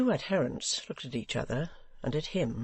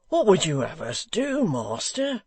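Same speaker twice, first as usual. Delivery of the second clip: crying out; 5 semitones higher, 6 dB louder than usual. Acted. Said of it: "cried Hugh".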